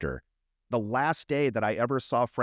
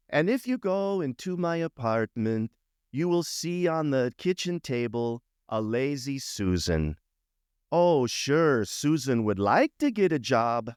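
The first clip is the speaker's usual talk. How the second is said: a lighter take on his high comic stage voice; Frank Manzano joke voice; 4 semitones higher than usual. Retold or imitated. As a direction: tell the joke the way he did on stage. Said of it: "his other great joke was".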